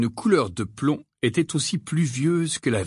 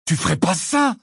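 horrified; 7 semitones higher, 4 dB louder than usual. Acted. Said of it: "horrifié".

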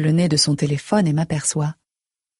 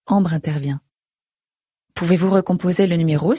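usual speaking voice

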